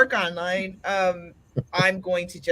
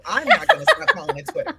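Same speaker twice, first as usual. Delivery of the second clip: laughing defiance; 4 dB louder than usual. Real, not acted.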